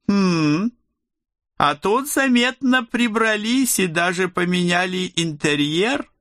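a hint of envy in a satisfied remark; touch of envious satisfaction. A narrator's reading. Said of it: "с лёгким оттенком завистливого удовлетворения заметил".

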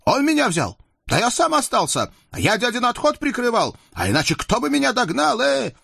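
high-pitched, heated protest; worked-up youth arguing back; fired-up indignation. A narrator's reading. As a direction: refuse the insult, proud and stung. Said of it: "Ну и парень загорелся".

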